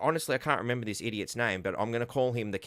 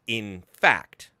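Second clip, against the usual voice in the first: "In fact" hit louder and clipped in mockery; emphasis on in fact; 2 semitones lower, 5 dB louder than usual. Mocking how the reporter says it, "In fact" punched. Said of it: "in the manner in which he says".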